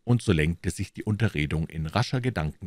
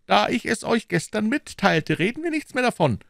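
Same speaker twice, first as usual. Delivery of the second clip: higher, quick, dismissive; Aramis closing the topic; 4 dB louder than usual. A narrator's reading. Aramis ends the talk with Porthos, quick and dismissive. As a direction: brush the subject off lightly. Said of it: "sprach Aramis".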